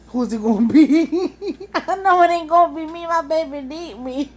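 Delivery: cracking herself up; laughing. Real, not acted. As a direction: giggle out the excuse. Joking who gets bit.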